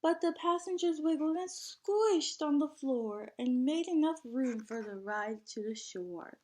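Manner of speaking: lively, sing-song storybook reading; bouncy picture-book narration; reading to children. A narrator's reading. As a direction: read the rhyme out to a class of kids.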